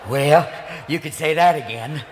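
folksy president impression, pitched low; Ronald Reagan. President answers a question about redundancy, low folksy voice. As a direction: answer low and genial, missing the point. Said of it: "Excuse me, Mister Reagan".

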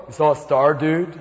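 a stoned magi asking; wise man. Acted. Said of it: "three wise men show up at your door and go".

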